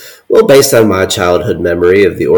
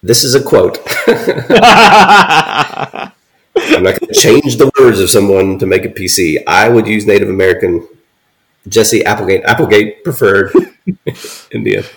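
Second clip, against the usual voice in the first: laughing through it. Real, not acted. Laughing while defending the quote.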